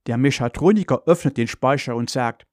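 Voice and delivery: usual speaking voice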